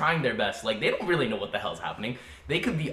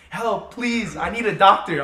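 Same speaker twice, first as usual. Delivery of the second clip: high panicked cry for help; hammed-up desperate plea; mock panic; 8 semitones higher, 7 dB louder, slower than usual. Panicked passenger in mock plane emergency.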